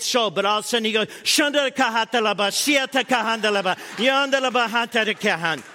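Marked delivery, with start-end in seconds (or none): 1.2-5.6 hammed-up speaking in tongues, a little higher; playful imitation of praying in tongues; mock tongues. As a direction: rattle off mock tongues like a fervent believer. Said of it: "my son goes Pentecostal".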